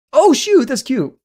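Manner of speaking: high, loud delighted gasp; excited squeal. Real, not acted. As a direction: gush over the cute art. Sees cute artwork.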